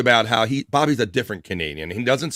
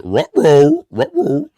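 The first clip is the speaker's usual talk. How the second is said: high, louder cartoon dog ruh-roh; Scooby ruh-roh; 7 semitones higher, 8 dB louder, much slower than usual. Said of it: "defense lawyer says".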